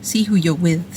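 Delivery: usual speaking voice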